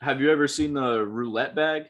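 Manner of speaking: ordinary talk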